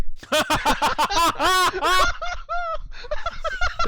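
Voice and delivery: strained gasping laugh. Acted. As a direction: wheeze out a fake seizure laugh. Faking a heart attack.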